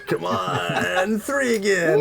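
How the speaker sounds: high, crying shout over bad rolls; dismayed shout. Real, not acted. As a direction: wail at the dice. Players cry out at bad rolls.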